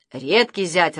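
ordinary delivery, nothing marked

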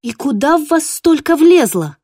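high, loud scolding; exasperated scolding. Acted. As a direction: scold the greedy dogs. Mom scolds the dogs.

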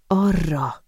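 usual speaking voice

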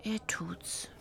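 soft disbelieving whisper; whispered aside. Acted. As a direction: mutter it to yourself. (ungläubig geflüstert)

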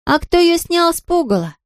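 high-pitched wondering question; puzzled girl. A narrator's reading. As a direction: ask like a baffled little girl. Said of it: "не понимала Марлотта".

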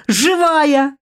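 joyful squeal. Acted. Tina squealing with joy at her waking sister. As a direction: squeal with relief she is alive. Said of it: "взвизгнула Тина".

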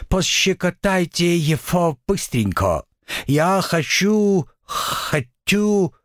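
high, slow petulant demon; whiny devil. Acted. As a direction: whine it like a spoiled brat. Devil demands, whining.

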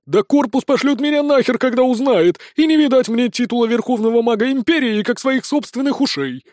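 put-on high, anxious young wizard. A narrator's reading. Fairy boy despairing over his lost career, high and anxious. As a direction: whine about losing your title.

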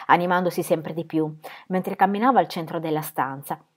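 ordinary delivery

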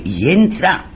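ordinary talk

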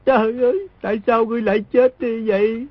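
fake wailing. Boy wails over the wounded man to fool the smugglers, hammed-up fake crying. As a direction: cry out high in fake grief. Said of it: "chỉ nghe y cao giọng kêu khóc".

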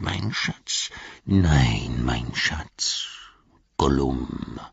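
sibilant half-whispered creature voice; hissing, whistling creature. A narrator's reading. Gollum talking to his precious while climbing, hissing. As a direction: hiss and whistle to yourself as you creep. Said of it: "zischelnde und pfeifende Stimme".

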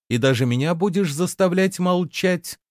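high-pitched, exclaimed question; exclaiming. A narrator's reading. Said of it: "воскликнула Лиса". (восклицая, высоким голосом)

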